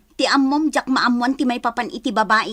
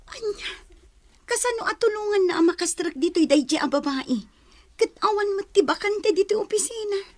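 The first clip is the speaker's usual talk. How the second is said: shocked disbelief; 9 semitones higher than usual. Acted. Wife hears of husband's woman.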